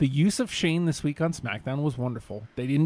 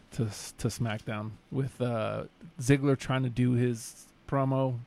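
ordinary talk, nothing marked

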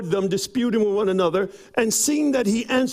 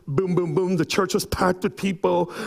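ordinary delivery

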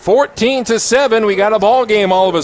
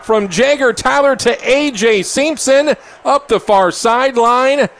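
excited play-by-play; 7 semitones higher than usual. Real, not acted. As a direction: call the long pass as it happens. Live play-by-play of a trick pass.

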